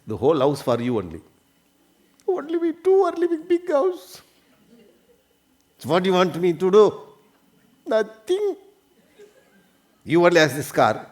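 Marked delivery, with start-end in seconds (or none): 2.3-4.2 high woman's voice, unimpressed; the wife shrugging off the big house; high, plaintive wife; complaining wife. Retold or imitated. 7.8-8.6 high, flat-out nothing from the wife; the wife answering her husband, high and sulky; high sulky reply. Retold or imitated.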